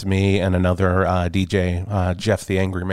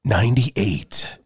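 radio announcer voice; slower than usual. Acted. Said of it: "that announcer voice you're doing".

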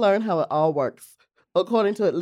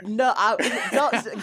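laughing; 5 semitones higher, much slower than usual. Real, not acted. Laughing denial.

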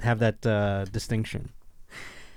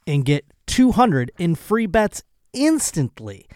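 upbeat sponsor read, loud and a bit higher; 5 semitones higher, 5 dB louder, slower than usual. Acted. Host to podcast listeners, pitching a sponsor in an upbeat read. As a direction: sell the bet like a hyped sports host.

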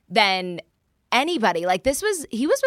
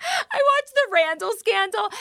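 high, giggly repeat; giggling as she repeats the phrase; laughing; 10 semitones higher than usual. Real, not acted. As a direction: say it again through a laugh.

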